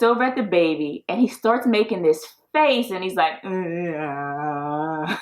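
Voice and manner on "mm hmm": low, soft whale moan from Dory; low whale-call hum; whale sound hum. Retold or imitated. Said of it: "doing the whale sound from Door".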